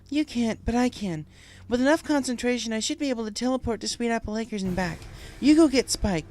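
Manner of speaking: steady resolve; Twilight determined. Acted. Twilight telling friends her plan, determined.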